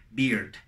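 "beard" with an ee vowel, as a wrong bird; mispronounced bird. Retold or imitated. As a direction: imitate learners saying "beard" for bird. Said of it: "No es beard". ("beard" con I, imitando el error)

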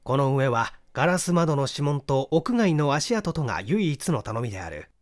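plain reading voice

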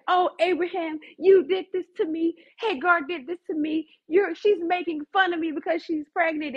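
high, complaining wife; dramatic Sarah. Retold or imitated. Sarah blaming Abraham for Hagar, dramatically complaining. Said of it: "very dramatic".

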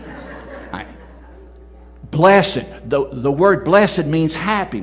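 loud, high fervent preaching; full-force revival sermon. Acted. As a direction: thunder the verse at the congregation.

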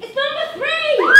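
loud, high winner announcement; excited shout. Acted.

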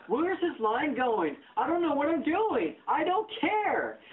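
high, drawn-out mocking whine; mimicked clueless complaint. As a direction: stretch the words high, mocking a line-dweller. Mimics clueless people in line.

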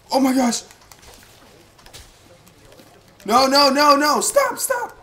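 high loud panicked pleading; panicked shouting. Real, not acted. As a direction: beg them to stop, panicking. Rats attacking again.

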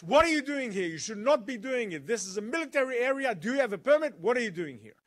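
loud, high angry challenge; angry guard. Retold or imitated. Guard confronting a trespasser on the hill, angry shout. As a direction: demand answers like an angry guard. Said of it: "He's very angry".

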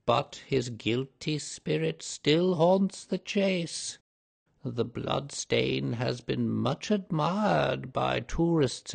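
slow, ominous housekeeper telling the ghost legend; mysterious voice. A narrator's reading. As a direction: draw out each word like a dark secret. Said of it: "same low, mysterious voice".

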